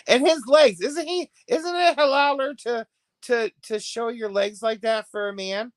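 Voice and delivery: gossiping with disbelief about his legs; incredulous question. Real, not acted. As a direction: ask it in mock disbelief.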